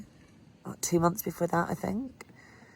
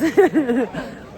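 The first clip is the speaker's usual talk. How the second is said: relieved laugh; 8 semitones higher, 7 dB louder than usual. Real, not acted. Laughs at "of sorts.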